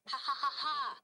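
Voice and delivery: loud, high belly laugh; imitating a big family laugh; hearty laugh. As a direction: laugh out like the whole table erupts. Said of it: "Another goes".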